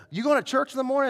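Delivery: eager shouted question, acted out; retold calling out. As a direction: imitate someone calling after a person walking off. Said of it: "he yelled".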